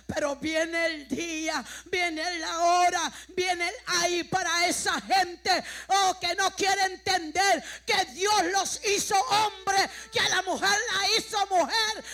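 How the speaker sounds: slow, thunderous shouted warning; full-force preaching. Acted. Preacher warning that the day is coming, full-force shouted preaching. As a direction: build to a sustained shout, stretching each phrase.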